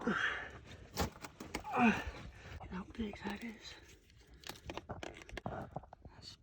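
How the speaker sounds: hushed whisper of real awe; awed whisper. Real, not acted. Whispering in awe at a giant shard in the cave. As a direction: breathe it out, slow and hushed.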